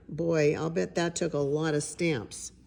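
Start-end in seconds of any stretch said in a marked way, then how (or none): none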